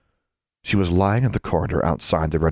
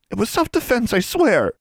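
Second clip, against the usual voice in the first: much higher, squeaky plea; high-pitched voice; 3 dB louder than usual. A narrator's reading. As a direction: protest innocence in a squeak. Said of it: "added in a high-pitched voice".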